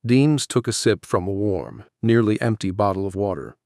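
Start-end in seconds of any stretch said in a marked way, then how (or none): none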